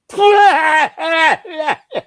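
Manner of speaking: imitating a wife's sudden high sob-laugh; a high wailing sob-laugh that breaks into tears; mock crying wail. Husband retelling how his wife burst into a high crying wail. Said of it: "starts crying".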